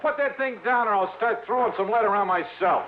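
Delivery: high yelling, slow and startled; shouting. Acted. Johnny yells at Sidewinder over the gun, startled shout. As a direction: holler at him to put the gun down.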